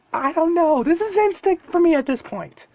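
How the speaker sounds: high-pitched character voice, defensive answer; voiced young hero's reply. Deku answering the dragon in story.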